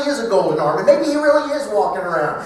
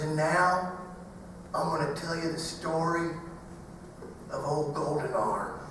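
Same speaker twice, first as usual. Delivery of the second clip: low, hushed scary-story voice, lower and softer; spooky campfire storyteller; 3 semitones lower, 12 dB quieter than usual. Retold or imitated. A dad spooking little boys at the campfire, hushed and low. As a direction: lean in and spook the kids. Dad with flashlight under chin.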